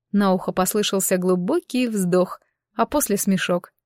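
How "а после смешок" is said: usual speaking voice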